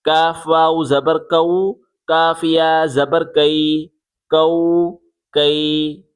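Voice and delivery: light, forward k on "کاف", "کو", "کئی"; thin kaf. Shown as a demonstration. Teacher repeating for pupils, light kaf. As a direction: keep the k of "کاف" thin, vowels drawn out. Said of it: "باریک کر کے پڑھیں".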